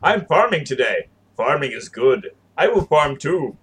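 stilted mock robot greeting; robot duplicate. Co-host imitating duplicates greeting each other, mock robot. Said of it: "gonna make duplicates and they're gonna walk around going".

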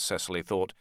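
plain reading voice

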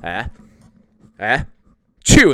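high, loud fake sneeze building to "chew"; mock sneeze. Dad acts out the teacher's sneeze, loud and high. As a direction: ham up a big teacher sneeze. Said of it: "she's like".

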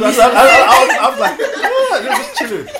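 high-pitched laughing disbelief. Real, not acted. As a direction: squeal it out through laughter. Laughing disbelief at mum's look.

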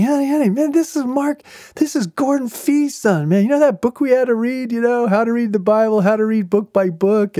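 high-pitched, enthusiastic imitation of a seatmate; all excited. Husband telling his wife who he just met, all excited. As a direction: gush eagerly, trying to get your wife's attention. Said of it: "so he's all excited".

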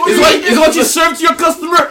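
high shouting chef disbelief; mock outraged yell. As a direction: bellow it at the cook. Acted chef scolding.

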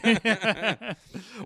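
high, light laugh; giggling laugh. Real, not acted. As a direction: laugh at your own joke. Laughs after sarcastic yay.

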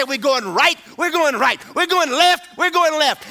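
rising shouts of right and left; shouted commands. Acted.

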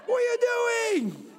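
high shouted yell at the goalie. Retold or imitated. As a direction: yell him back into the goal. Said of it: "I was like".

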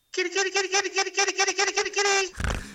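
squeaky, very high cat call; high kitty call. Pretend. Calling a cat, Alien reference.

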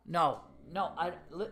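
usual speaking voice

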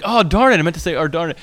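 hammed-up correction modelling a clean word; mock correction. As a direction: make a show of fixing the swear. Self-correction in front of daughter.

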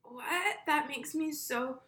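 lightly hammed-up British accent, rising high; mock British accent. Mocking Cassie's reaction in a put-on British accent. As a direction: fake a British accent with a hint of a pout. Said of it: "What accent is that?".

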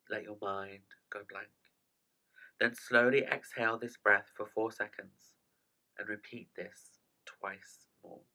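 slow, calm meditation guide; guided breathing. Acted. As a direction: talk them through each breath gently. Leads a breathing exercise.

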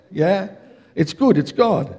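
ordinary talk, nothing marked

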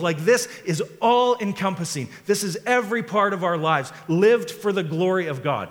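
high, loud impassioned preaching; fervent sermon peak; full-force preaching. Acted. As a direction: preach it with fire.

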